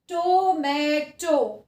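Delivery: "tomato" very slow, with full, drawn-out vowels. Shown as a demonstration. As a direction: let each vowel in "tomato" ring out long. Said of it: "the walls make a louder sound".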